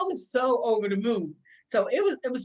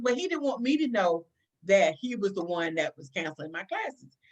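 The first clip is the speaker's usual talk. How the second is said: usual speaking voice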